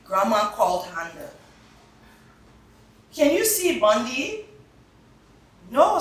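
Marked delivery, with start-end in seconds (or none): 3.1-4.5 put-on bright, raised voice for a child asking; high, eager child's question; lively child's voice. Reading dialogue to children. 5.7-6.0 high, loud no; a firm grandma's no; loud playful no; emphatic no. Acted. Grandma's answer.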